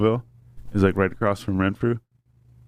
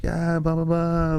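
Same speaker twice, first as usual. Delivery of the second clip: higher-pitched imitation of French chatter; mock French chatter; 8 semitones higher, slower than usual. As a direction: lightly imitate passersby babbling in French. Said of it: "like, French stuff".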